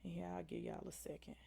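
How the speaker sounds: breathy whisper to the viewers while they type; whispered aside. Real, not acted. Host whispering to viewers while waiting for chat. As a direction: drop to a whisper, almost off-mic.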